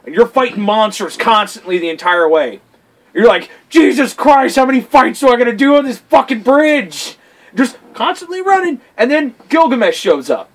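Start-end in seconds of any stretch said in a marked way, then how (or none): 3.7-7.2 higher-pitched exasperated outburst; mock exasperation, pitched up; exasperated player. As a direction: voice a fed-up gamer venting. Voicing an exasperated player mid-game.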